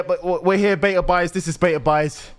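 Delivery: usual speaking voice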